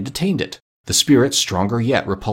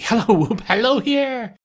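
high-pitched, giddy calling; joyous whoop; 13 semitones higher than usual. A narrator's reading. Scrooge happy to be a baby, joyous whooping. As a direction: whoop and halloo, pitch soaring.

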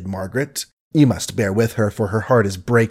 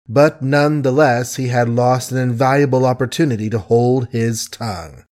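ordinary delivery

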